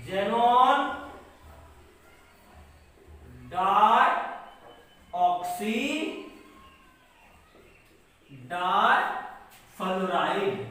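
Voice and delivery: drawn-out parts with long pauses; slow dictation. Shown as a demonstration. Teacher dictating a compound name for writing, slow dictation.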